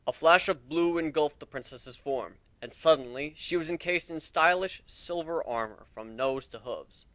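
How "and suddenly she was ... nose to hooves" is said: usual speaking voice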